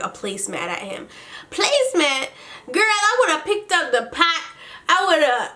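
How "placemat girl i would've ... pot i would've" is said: high-pitched, incredulous scoffing; mock outrage. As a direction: exclaim as if it's absurdly feeble. Mock outrage at the weak throw.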